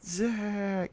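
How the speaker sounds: eerie drawn-out ghost call; ghostly howl. Acted. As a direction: call the name like a wailing ghost. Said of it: "a ghostly howl".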